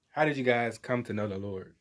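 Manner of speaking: usual speaking voice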